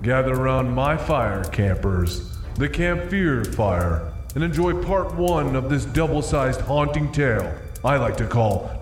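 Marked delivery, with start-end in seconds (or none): none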